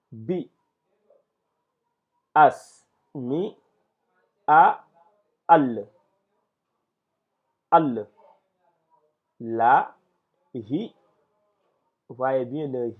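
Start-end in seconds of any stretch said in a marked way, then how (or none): 0.1-10.9 each syllable alone with long silences between; detached syllables. Shown as a demonstration. Teacher teaching detached reading, isolated syllables. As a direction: sound out syllables one by one, many punched louder. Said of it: "que nous devons voir en détaché".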